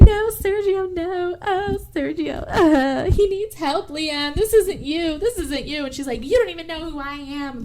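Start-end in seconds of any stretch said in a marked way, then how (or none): 0.0-5.8 high wailing plea, no real shout; hammed-up tearful wail, drawn out; mock screaming. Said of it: "they're screaming back and forth". 6.2-7.6 half-hearted high-voiced outburst; mock screaming. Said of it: "they're screaming back and forth".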